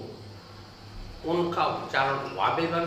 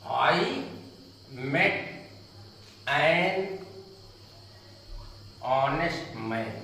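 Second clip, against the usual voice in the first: slow model reading; much slower than usual. Shown as a demonstration. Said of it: "अब आप देखें".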